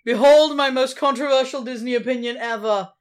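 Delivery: loud, high mock-heroic announcement; booming, hammed-up declaration; grand proclamation. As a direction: herald your opinion with mock pomp. Announcing a hot take with fanfare.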